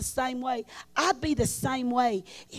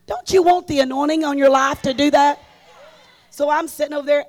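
ordinary talk, nothing marked

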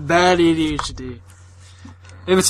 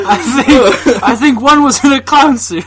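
laughing; 7 semitones higher, 5 dB louder, slower than usual. Real, not acted. Giggling over the joke.

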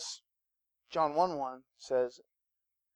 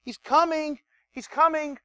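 much higher, excited He's coming; mock prophets' cry; 10 semitones higher, 3 dB louder than usual. Preacher imitating prophets heralding Jesus, high and excited. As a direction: announce it like an eager prophet. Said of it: "prophets who are yelling".

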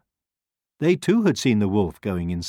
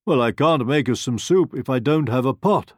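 wolf's lifted, louder storybook voice; wolf character; 6 semitones higher, 5 dB louder than usual. A narrator's reading. Wolf explaining stone soup to the hen, persuasive wolf. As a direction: explain it with raised pitch and energy. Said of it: "said the wolf".

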